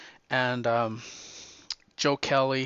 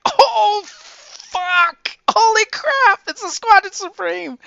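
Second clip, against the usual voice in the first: high, loud, thrilled outburst; a fan shouting at the big reveal; shocked excitement; 9 dB louder, slower than usual. Retold or imitated. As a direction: yell it like the twist just hit you. Reader's shocked reaction to reveal.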